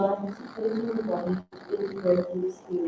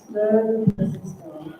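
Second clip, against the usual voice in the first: slow, whispered demo pace. Shown as a demonstration. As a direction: draw it out in a hush. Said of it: "I'm gonna go a lot slower".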